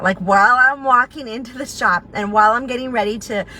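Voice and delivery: usual speaking voice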